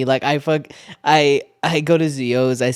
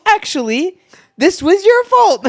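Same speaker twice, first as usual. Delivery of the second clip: mock blame; 5 dB louder than usual. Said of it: "you go".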